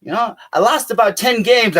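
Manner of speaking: normal talking voice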